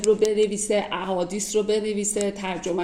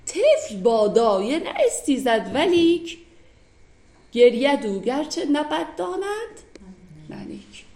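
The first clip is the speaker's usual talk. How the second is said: dramatic recitation; 2 semitones higher, slower than usual. Shown as a demonstration. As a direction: declaim the couplet like a plea, slowly. Rereads the couplet with feeling.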